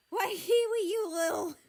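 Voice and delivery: high, very loud outburst, cut off; angry shout. A narrator's reading. Australia rounds on Kiwi after losing, shouting angrily. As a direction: yell at your little sister in outrage. Brother rages at sister, interrupted.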